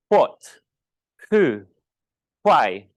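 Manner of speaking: "what", "who", "why" loud, with strong aspirated H at the start; overpronounced H. Shown as a demonstration. Teacher showing the class W-H words, "what" and "why" with heavy H. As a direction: punch a puff of H into "what" and "why". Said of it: "We overpronounce the H".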